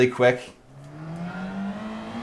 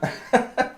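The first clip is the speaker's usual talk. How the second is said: a short, soft high laugh at himself; quick sheepish chuckle, much higher; embarrassed laugh; 10 semitones higher, 6 dB quieter than usual. Real, not acted. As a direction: racer laughing off his own frustration. Laughs at getting emotional.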